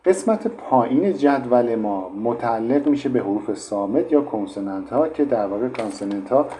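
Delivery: ordinary delivery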